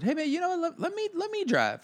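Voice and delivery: high, tiptoeing plea; mock-polite high voice; tentative request. Retold careful request to drive.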